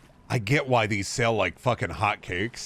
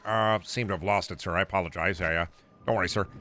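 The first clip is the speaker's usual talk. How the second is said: Smithers; 4 semitones lower, faster than usual. Acted. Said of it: "Burns ordering Smithers to".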